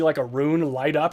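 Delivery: ordinary delivery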